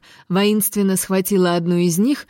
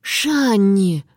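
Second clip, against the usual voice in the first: "Шанни" with a long stretched first vowel; drawn-out name. A narrator's reading. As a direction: draw out "Шанни" pleadingly. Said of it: "протянула Амбер".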